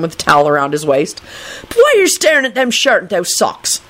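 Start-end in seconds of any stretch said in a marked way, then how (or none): 1.7-3.8 put-on high, lilting Irish accent; thick Irish brogue. A narrator's reading. Dugan stepping out of the bathroom, Irish brogue. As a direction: ask it in a broad Irish brogue. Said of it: "a thick Irish brogue".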